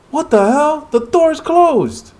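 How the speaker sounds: frightened hunter yelling in alarm; panicked shout. Acted. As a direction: shout it as the door slams shut. Character panics at slammed door.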